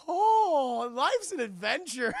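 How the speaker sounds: loud, wide-eyed, slowed-down wonder; a baby marvelling at the world; childlike Superman. Acted. Looking around, Superman speaks like an awed child. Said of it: "He's like a child".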